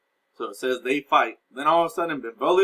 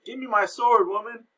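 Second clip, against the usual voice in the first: mock demand; 7 semitones higher than usual. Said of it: "He's like".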